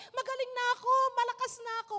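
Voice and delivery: high, joyful cry of being healed; joyful shout. Retold or imitated. A healed woman bursting to cry out, joyful. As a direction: want to shout it out. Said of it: "Gusto nga niya magsisigaw eh".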